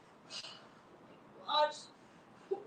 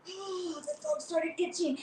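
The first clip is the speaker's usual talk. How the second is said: usual speaking voice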